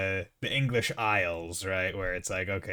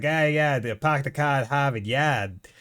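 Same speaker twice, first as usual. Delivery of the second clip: loud, hammed-up Boston accent; movie Boston accent; 3 semitones higher, 5 dB louder than usual. New Englander parodying movie Bostonians, broad Boston accent. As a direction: drop every R, movie Bostonian style. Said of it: "a Boston accent that you see in movies".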